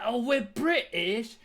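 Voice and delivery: slow, drawn-out fake British "Oh, we're British?"; mock British accent. Streamer teasing the British voice clip in a mock British accent. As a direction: lightly ham up a posh British reply. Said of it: "Oh, you're British?".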